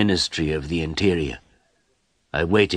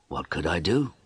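ordinary delivery

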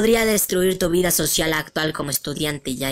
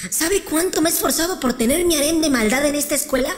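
loud scheming rant, pitched up; villain rant; 7 semitones higher, 6 dB louder than usual. Acted. Villain rants about rival stealing her harem, loud. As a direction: snarl it like a cartoon villain.

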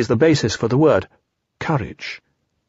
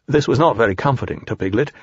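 ordinary delivery

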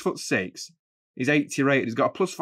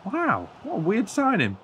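mock amazement; 9 semitones higher than usual. Real, not acted. Reacting to ex-player signing.

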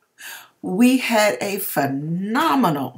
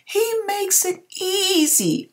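voice breaking; 14 semitones higher, slower than usual. Real, not acted. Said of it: "I'm trying not to get emotional".

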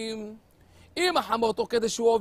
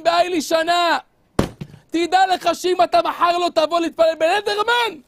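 loud, raised rebuke; stern warning; 6 semitones higher, 6 dB louder than usual. Acted. Rav Chaim warning the handcuffed young man, stern and loud. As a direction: lay down the ultimatum.

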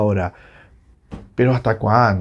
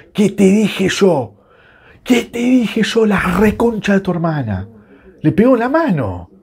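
indignado, con razón; 7 semitones higher than usual. Real, not acted. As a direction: build to a high, indignant cry. Vindicated over handball, escalating. (reclamo triunfal, cada vez más agudo)

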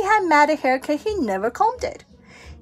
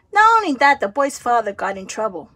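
plain reading voice

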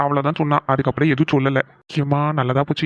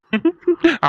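short high burst of laughter; quick high laugh at the joke; 12 semitones higher than usual. Real, not acted. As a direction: laugh it off brightly. Amused reply to family joke.

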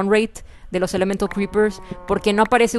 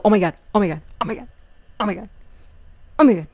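ordinary delivery

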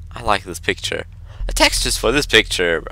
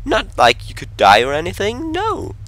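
high, mock-carefree sarcasm; sarcastic; 10 semitones higher than usual. Player mocks the danger of going outside, sarcastic. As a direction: swoop very high on the final word.

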